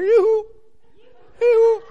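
high, loud yoo-hoo call. Retold or imitated. Man trying to get the camera's attention, high mock calling. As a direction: hail someone far off in a high hoot.

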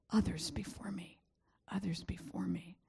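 breathy whispered motto. Retold or imitated. Preacher whispers the selfish motto to the congregation. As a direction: whisper it like a private mantra.

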